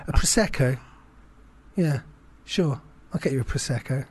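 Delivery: forced polite agreement. Retold or imitated. Buying a round for an old rival, forced cheerfulness. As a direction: swallow it and say it cheerfully.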